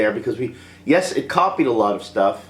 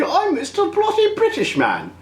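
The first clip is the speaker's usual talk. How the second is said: put-on high, hammy British accent; mock British accent. Vlogger mocks grown Anakin's British accent. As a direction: puff up into a pompous British accent. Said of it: "he didn't speak with a British accent".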